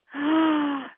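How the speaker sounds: high re-enacted gasp of awe; swooning gasp. Turning to see the handsome man, re-enacted swooning gasp. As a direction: gasp as if struck by his looks.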